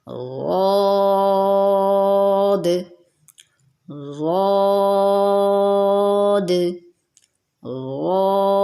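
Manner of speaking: غاد held long on a straight alif, no w sound; drawn-out heavy ghaad. Shown as a demonstration. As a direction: stretch غاد out, heavy, from the side of the tongue. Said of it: "الف کے ساتھ سیدھا پڑھیں گے".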